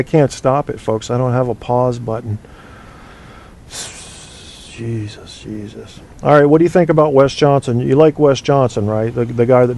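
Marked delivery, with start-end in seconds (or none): 3.7-6.0 exasperated mutter. Real, not acted. Exasperated at the puppet.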